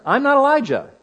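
high-pitched, emphatic quoted denial; no uncertain terms. Retold or imitated. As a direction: make John's denial unmistakably clear, pitch jumping up. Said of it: "no uncertain terms".

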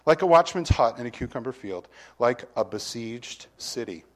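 solemn Bible reading from the pulpit; scripture reading. Acted. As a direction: read the verse out to the congregation with weight. Reads scripture aloud.

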